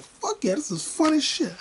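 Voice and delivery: high-pitched excited cheer; retold glee. Retelling his glee at the movie.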